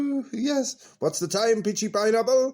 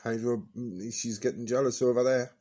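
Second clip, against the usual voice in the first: ordinary talk, nothing marked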